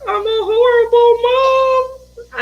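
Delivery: high, drawn-out, hammed-up dismay; guilty lament. Mother realizing her son watched her smoke, high slow lament. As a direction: wail it slowly, mock-despairing. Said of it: "And I felt like".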